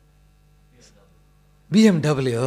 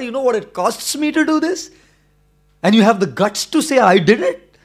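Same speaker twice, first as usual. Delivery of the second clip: put-on loud, high, furious yelling at the boy; outraged shouting; 7 semitones higher, 10 dB louder than usual. Car owner yells at the boy who threw the stone, furious shout. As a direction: bellow it like a man whose new car was just smashed. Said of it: "He was outraged".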